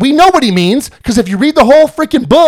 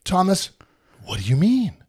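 lower, softer bewildered disciple; lower, quieter doubting Thomas; confused Thomas; 6 semitones lower, 9 dB quieter than usual. Retold or imitated. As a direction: mumble it like a baffled doubter.

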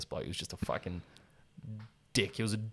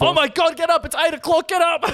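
high, loud nagging parent; shrill mock mum yelling get up; mock parent wake-up call; 7 dB louder than usual. Parent waking kids mockingly.

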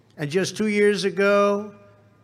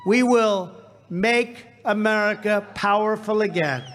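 shouted rally climax; 3 semitones higher than usual. Real, not acted. Rally climax, crowd chant lines.

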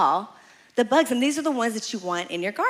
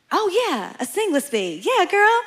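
fake agreement; 9 semitones higher than usual. Faking agreement with friend's bee name.

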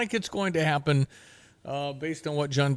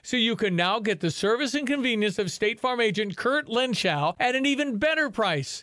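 ad read; 5 semitones higher than usual. Acted. Reads live insurance ad.

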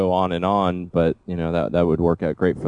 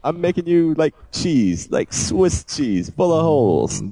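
mobster voice; 8 semitones higher than usual. Pretend. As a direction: drag out the threat like a hammy hitman. Continues mob hit parody.

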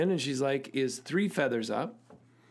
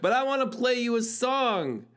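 laughing restart; 9 semitones higher than usual. Real, not acted. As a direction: start over with a grin after the laugh.

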